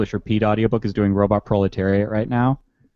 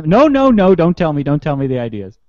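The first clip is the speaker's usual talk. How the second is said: mock loud refusal; 7 semitones higher, 5 dB louder than usual. Mock refusal of John's ideas.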